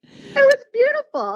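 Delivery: choked up, pitch cracking high; fighting back tears on the word beautiful; tearful catch in the voice; voice breaking. Real, not acted. Tearful memory of late father.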